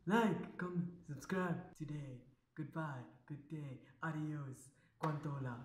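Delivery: very soft, dying away; low, hushed, slowing farewell; fading sign-off. Acted. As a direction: let the goodbye shrink to almost nothing.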